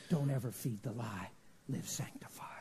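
low, soft, fading into a whisper; hushed command. Retold or imitated. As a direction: let the solemn charge sink to a whisper. Retold word from the Lord.

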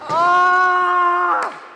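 sudden loud yell of frustration; loud frustrated shout. Real, not acted. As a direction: belt out a disappointed cry. Shot on goal, misses.

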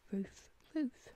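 ordinary talk

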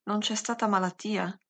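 usual speaking voice

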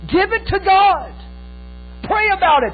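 slow, loud preacher's cry; impassioned preaching. Acted. Preacher urging the church, loud and slow. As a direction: drive it home to the room.

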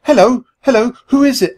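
high, loud demanding hello; loud, high and demanding into the phone. Acted. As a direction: insist on an answer, loud and high. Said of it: "I demanded".

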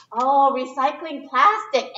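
ordinary delivery, nothing marked

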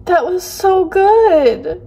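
high, loud, drawn-out tearful wail; tearing up. Real, not acted. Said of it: "tearing up right now".

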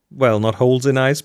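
normal talking voice

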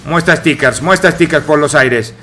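normal talking voice

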